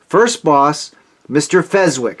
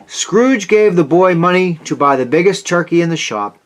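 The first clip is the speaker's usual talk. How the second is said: usual speaking voice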